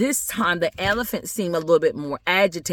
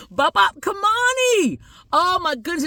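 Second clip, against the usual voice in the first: theatrical exclamation; 12 semitones higher than usual. Acted. Storyteller exclaims over the little boy's name, theatrical. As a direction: exclaim it way up high.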